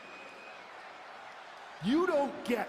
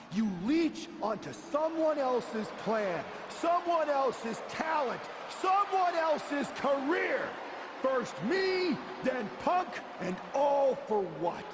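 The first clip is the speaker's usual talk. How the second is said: higher, rising tirade in a wrestling promo; angry accusation; 5 semitones higher than usual. Acted. Wrestler confronting a disloyal rival, angry building accusation. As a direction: hurl each accusation with mounting force.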